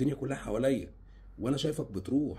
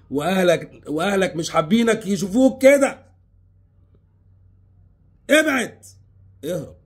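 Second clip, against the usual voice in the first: louder, higher pleading that builds to a shout; loud desperate plea; 7 semitones higher, 10 dB louder than usual. Acted. Begging the young man to leave her, loud and rising. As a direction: beg him with rising urgency to get away.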